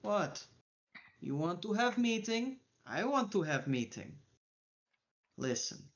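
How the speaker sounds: put-on a menacing, heavily accented crime boss making demands; the Mac threatening Lucky, in a thick foreign gangster accent; the Mac's accent. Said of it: "the Mac is just like".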